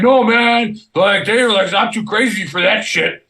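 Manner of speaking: high-pitched, worked-up buddy voice; excited retort. Retold or imitated. Soldier explaining he washed out of selection, excitedly. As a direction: imitate a keyed-up friend, pitched high.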